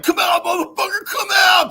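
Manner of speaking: high, loud furious yelling; angry yell. Retold or imitated. Brothers outside his room yelling at him to come out. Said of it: "you know, like".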